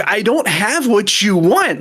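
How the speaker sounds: exasperated quote. Retold or imitated. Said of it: "I'm standing there and I'm like".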